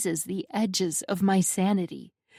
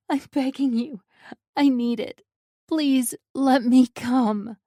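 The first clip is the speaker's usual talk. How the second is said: rising, louder, slowing plea; begging; 5 semitones higher, 5 dB louder than usual. A narrator's reading. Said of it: "I beg".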